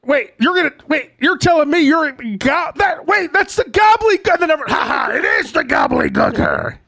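high, shouted stagey disbelief; mock amazed announcer. Announcer as the egg hatches, mock amazed shout. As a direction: build from puzzlement to a hammed-up cry of discovery.

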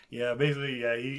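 ordinary delivery

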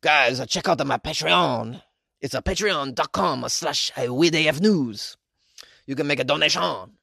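put-on slow, lightly French-accented plug; a hint of a French accent, slow; lingering French accent. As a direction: keep a hint of the French accent while plugging. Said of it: "with a French accent".

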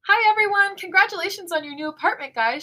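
high, loud cheery congratulations; squealing delight that settles slightly; excited. A narrator's reading. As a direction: gush excitedly to the whole group. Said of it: "she grins, clapping her hands excitedly".

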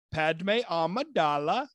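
slow, lightly put-on funny voice. Wife wandering the apartment, saying the name in a slow funny voice. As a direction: drag the name out in a silly voice. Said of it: "with that funny kinda sounding voice".

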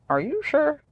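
high, soft mock self-doubt; doubting inner voice. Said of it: "I'm always like".

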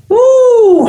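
high, loud drawn-out woo. Retold or imitated. Veteran teasing a nervous newcomer, high mock woo. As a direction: let out a high mock-pitying whoop. Mock sympathy for new actor.

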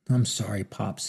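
plain reading voice

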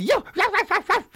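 very high yapping yows; little dog yapping. Retold or imitated. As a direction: yap like a Chihuahua. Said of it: "And he just a little".

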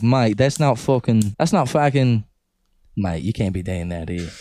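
mock Australian telling-off; Australian accent. Imagined Australian scolding the speaker, mock Australian accent. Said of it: "You gotta go to Australia".

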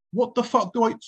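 alarmed outburst. Retold or imitated. Acted startled question to passerby.

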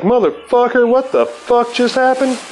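high-pitched disbelieving shout, imitating himself; mock outraged yell. Teller yelling at drenched Bob, high mock outrage. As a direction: squeal it in shocked disbelief.

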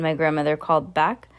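plain reading voice

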